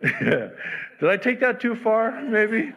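laughing. Real, not acted. Preacher laughing at his own joke to the congregation, laughing. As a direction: chuckle through the question.

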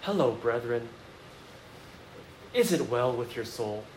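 mimicking weird churchgoer speech; pious churchy talk. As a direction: parody an overly spiritual church voice. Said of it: "everyone just talks like this".

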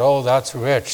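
usual speaking voice

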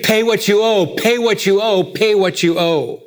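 each PAY hammered hard, repeated like a demand; emphatic demand. Acted. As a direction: drive the word pay home three times. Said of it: "Emphatically in the Greek".

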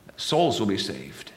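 ordinary talk, nothing marked

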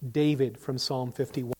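ordinary talk

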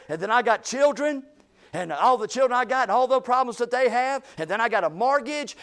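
high, loud, rising complaint piling up; agitated rant. Retold or imitated. Preacher voicing a stressed coworker's frantic, loud rant. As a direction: voice the stressed coworker pouring it all out.

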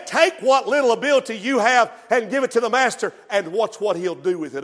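usual speaking voice

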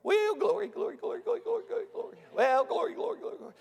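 very high, rapid little squeal of praise; imitating shouting churchwoman. Preacher mimicking Sister at the back of church, high-pitched praise. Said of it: "I heard somebody back there going".

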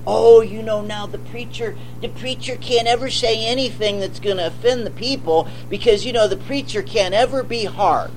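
mock the soft-hearted critics, whining; mocking whiny critic. Preacher mocking church critics, soft whiny mimic.

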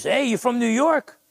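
retold call across the room, pitched high. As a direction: imitate someone hailing a stranger from afar. Manager calls from across the room.

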